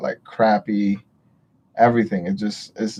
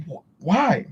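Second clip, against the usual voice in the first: sudden why; 9 semitones higher than usual. Retold or imitated. Recalling the moment it hit her, high and startled. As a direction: catch yourself in sudden disbelief. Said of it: "it just hit me like".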